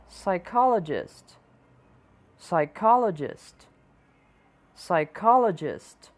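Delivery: psychologist starting with s, slow; silent p. Shown as a demonstration. Teacher slowly models psychologist with silent p. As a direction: skip the p in psychologist. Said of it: "Nem sempre as consoantes".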